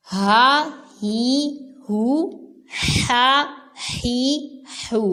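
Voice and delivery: teacher drilling both ہا sounds; two ha drill. Shown as a demonstration. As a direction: breathe each set from its own throat point. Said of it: "ہا حلق کے آخری حصے سے".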